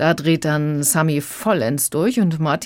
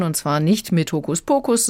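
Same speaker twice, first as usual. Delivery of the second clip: ordinary talk, nothing marked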